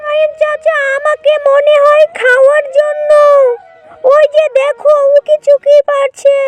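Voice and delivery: high-pitched bunny raising the alarm; anxious little rabbit. Acted. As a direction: warn uncle in a high rabbit voice.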